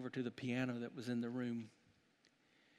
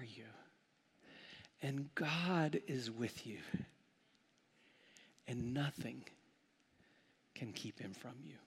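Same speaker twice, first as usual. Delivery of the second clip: quiet pastoral comfort; 5 dB quieter than usual. Acted. Pastor gently comforting listeners facing dark valleys, softly. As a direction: speak comfort to the hurting friend.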